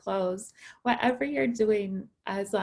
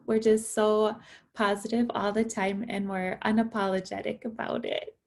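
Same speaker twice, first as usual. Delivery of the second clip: normal talking voice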